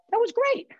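very high, loud gushing praise; hammed-up squeal of approval; delighted rave. Someone walking away from a good conversation, delighted high rave.

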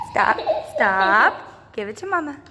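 ordinary delivery

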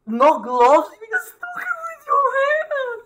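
loud, squealing disbelief; horrified high-pitched screech; shocked outcry. Real, not acted. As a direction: shoot up into a very high squeal of alarm. Shocked reaction to bug.

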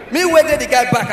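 ordinary talk, nothing marked

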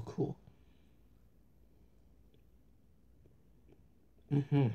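talking while chewing; words muffled by food; mouth full of cheese. Real, not acted. Seen 1: Bites orange cheese slice at 1.2–3.0, then visibly chews while speaking.

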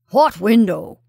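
loud, impatient question; exasperated older officer's bark; irritated colonel. A narrator's reading. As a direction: demand it loudly, out of patience. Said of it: "said the irritated Colonel".

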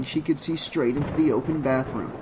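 usual speaking voice

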